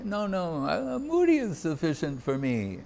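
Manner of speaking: imitating Gorindu. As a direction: politely wave off the food, softly. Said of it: "And he'd say".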